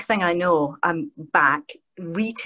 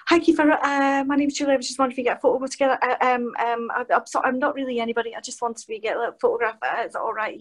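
high-pitched, rambling and hesitant; nervous fan; 7 semitones higher, faster than usual. Retold or imitated. Fan asking Kiefer for a photo, nervous and high. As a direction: imitate a starstruck, apologetic gabble. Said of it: "like, nervous and going".

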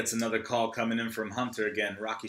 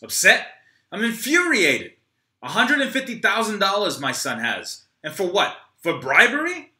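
high, loud furious outburst; 9 semitones higher, 9 dB louder than usual. Acted. Troy yells at Rocky over his son, furious. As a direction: explode in disbelief.

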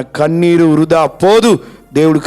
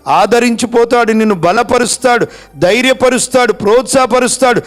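higher, louder building sermon; fervent preaching; 7 semitones higher, 3 dB louder than usual. Acted. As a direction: pile up each promise with rising force. Preacher building to full force. (ఉద్రేకంగా ప్రసంగం)

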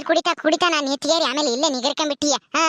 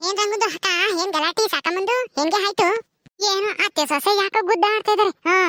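curious neighbour; 4 semitones higher than usual. Acted. Neighbour asking the other woman about the quarrel, curious and higher. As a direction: ask what the fuss is, lightly and curious.

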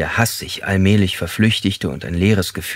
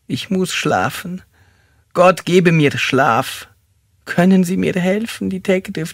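tearful lament; 10 semitones higher than usual. Acted. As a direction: beg for sleep in a plaintive high whine. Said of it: "Er weinte leise weiter".

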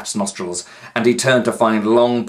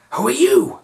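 much higher, louder question; high boy voice; 6 dB louder than usual. A narrator's reading. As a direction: ask up high and alarmed. Pat asks.

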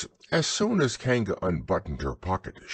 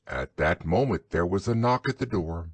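plain reading voice

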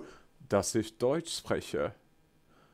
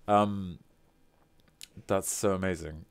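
usual speaking voice

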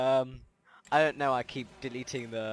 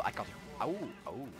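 ordinary talk

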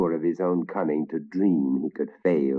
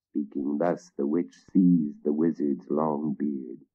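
usual speaking voice

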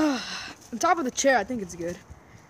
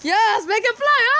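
excited; 8 semitones higher, 5 dB louder, slower than usual. Real, not acted. Cheering the kite launch.

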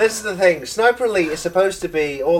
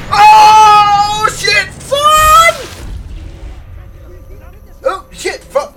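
high, loud yelp of alarm; panicked shout; 14 semitones higher, 8 dB louder, much slower than usual. Real, not acted. Caught by an enemy soldier, real panicked shout. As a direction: shriek it when you're caught.

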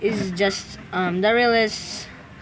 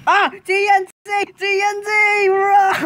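high, loud panicked scream; shrieked alarm at sudden danger; screamed warning; 10 semitones higher, 3 dB louder than usual. Real, not acted. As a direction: scream for everyone to run. Screams warning about explosive.

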